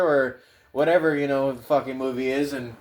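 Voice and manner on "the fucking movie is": ordinary talk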